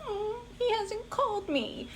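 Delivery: high, slow, whimpering mock whine; hammed-up teary whine; mock self-pity. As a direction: whine it like a sulking child. Acting out self-pitying inner voice.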